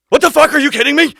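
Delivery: loud, high, stunned disbelief; shocked outburst. Retold or imitated. Singer shouting in shock on hearing he is hired. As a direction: yell it like you can't believe the call. Imagined shocked reaction on phone.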